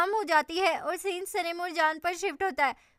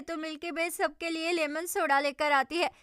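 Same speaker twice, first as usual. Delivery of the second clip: plain reading voice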